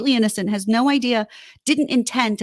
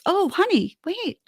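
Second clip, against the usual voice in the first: an acted tender wife's voice, pitched up; gentle caring wife; 4 semitones higher than usual. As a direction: coax him tenderly to open up. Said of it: "But just".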